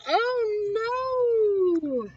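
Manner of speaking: high panicked "oh no" while falling; frightened cry. Acted. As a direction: cry out as he slips. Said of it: "letting go".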